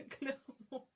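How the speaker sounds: word breaking out of a chuckle; laughing. Real, not acted. Admitting her mistake to viewers, laughing. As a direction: say it through a real laugh.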